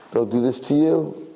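mocking echo. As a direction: throw the plea back at him. Masters echo the boy's plea.

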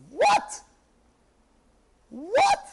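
sharp, high-rising yelp of disbelief; shocked disbelief. Retold or imitated. Aide hearing the letter's wording, shocked. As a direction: react aghast, pitch shooting up.

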